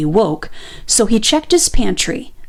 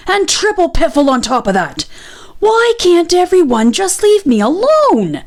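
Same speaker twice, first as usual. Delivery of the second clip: angry outburst; 8 semitones higher than usual. A narrator's reading. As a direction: let the badger's fury boil over. Badger yells at Rabbit.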